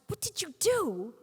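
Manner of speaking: high, loud shocked outcry; acted horror, pitched right up; aghast gasp. Mother seeing her daughter's hacked hair, aghast. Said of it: "and she gasps".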